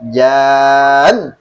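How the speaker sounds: JAN stretched long and loud, swooping up at the end; intonazione rialzata. Shown as a demonstration. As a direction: hold JAN, then jump up in pitch. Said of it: "nggak perlu digituin".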